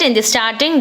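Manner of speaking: ordinary delivery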